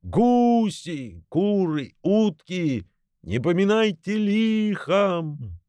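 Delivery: high, slow calling goodbye; departing goose crying out; gander's farewell call. A narrator's reading. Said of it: "гусак кричал".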